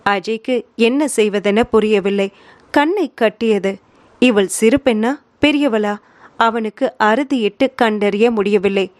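ordinary delivery, nothing marked

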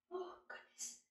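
breathless whispered wonder; awed whisper. Acted. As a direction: gasp it out softly. Rose wakes to find gold.

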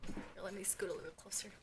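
quick whisper to the viewers; hurried confiding whisper; fast whisper. Real, not acted. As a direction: whisper it while leaning in. Leans toward camera.

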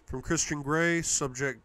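plain reading voice

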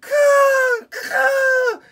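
very high, loud eagle caw; eagle cry. Acted. Mother eagle calling to her babies, loud high caws. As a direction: call like a mother eagle from the sky. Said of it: "most beautiful eagle sound".

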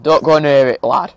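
loud, firm warning to the dog. Retold or imitated. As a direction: call it out sharply.

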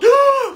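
high sudden scream of alarm; startled scream. Acted. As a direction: let out a sharp high scream.

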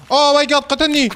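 startled high yelp; sudden shocked cry. Real, not acted. Machine crushes him in game.

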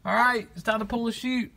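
high, thrilled pretend drag racing; excited race call. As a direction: call the run like it's real.

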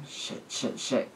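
hushed, unvoiced whisper to oneself; whispered private panic; panicked whisper. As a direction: whisper the curses under your breath. Panicked inner thought, whispered.

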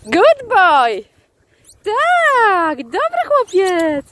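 high, drawn-out delighted praise; excited dog praise. Real, not acted. Chwali psa po aporcie. (wysokie, przeciągłe chwalenie psa)

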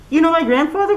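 put-on high, surprised girl; high curious child's question; girl's voice. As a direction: lift it up in wonder like a child. Colleen voiced in story.

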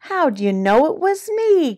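high-pitched turkey groan; mock-dismayed groan, pitch swooping up. A narrator's reading. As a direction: groan it high, falling at the end. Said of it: "groaned Turkey".

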